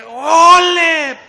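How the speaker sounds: olé fuerte, agudo y alargado; olé de torero. Retold or imitated. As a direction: lanza un olé imitando al torero. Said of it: "Y el torero". (grito taurino a todo pulmón)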